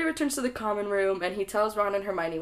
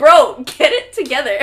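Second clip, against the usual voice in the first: loud, higher scolding outburst; exasperated shout; 4 semitones higher, 13 dB louder than usual. Acted. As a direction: yell it at someone dawdling. Exasperated at Dumbledore's slow response.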